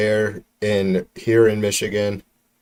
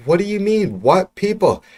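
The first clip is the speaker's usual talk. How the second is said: much higher, stunned and indignant; incredulous outrage; 10 semitones higher than usual. Real, not acted. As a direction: ask it in total disbelief. Incredulous outrage at genetics remark.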